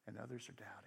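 a sudden, dramatic whisper; hushed stage whisper on the doubters. Acted. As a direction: drop to a whisper to land the point.

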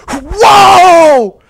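loud high whoa; startled shout. Acted. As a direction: jump back in shock. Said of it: "and he goes".

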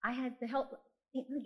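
normal talking voice

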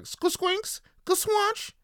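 high, squeaky slicing noises; imitated sword slashes. Acting out an anime swordsman slicing someone, high mock slash noises. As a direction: make squelchy sword-slash sound effects up high.

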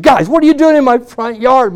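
high, loud exasperated challenge; loud retold confrontation. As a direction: call out sharply to break up a fight. Confronting brawling brothers in retelling.

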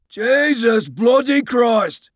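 high, drawn-out Australian shout; Aussie-accented cry. A narrator's reading. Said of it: "interrupted by a cry from the stacks, followed by Halstead's distinctive Aussie accent".

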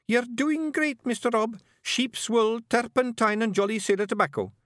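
high, gentle wee pictsie; Billy's voice. Acted. Billy reassuring Rob, in a high gentle voice.

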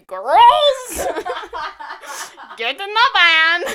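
loud, high hammed-up lure; creepy van kidnapper. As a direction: shout it from the van like a cartoon abductor. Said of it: "I say".

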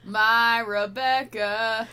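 very slow, drawn-out mock melodrama; milk the name for laughs; hammed-up drawl. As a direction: stretch each word out theatrically. Hams up the line just read.

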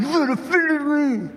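high, drawn-out mock complaint; mock offended. Preacher caricatures an offended churchgoer, mock offended. Acts out a wrong way to react.

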